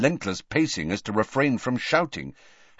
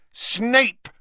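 high, furious outburst; very loud; 13 semitones higher, 5 dB louder than usual. Acted. Harry furious at Dumbledore's remark, very loud. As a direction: spit the name out loudly. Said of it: "he said very loudly".

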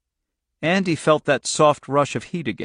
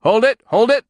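much higher, louder barked order; yell; 10 semitones higher, 7 dB louder than usual. A narrator's reading. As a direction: yell at them to freeze. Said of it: "one of them yelled".

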